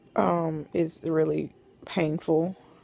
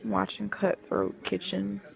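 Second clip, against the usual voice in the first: quiet; 3 semitones higher, 7 dB quieter than usual. Real, not acted. Sick in bed after surgery.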